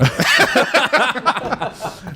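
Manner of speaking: high burst of laughter. Real, not acted. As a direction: crack up at the joke. Laughing at own joke.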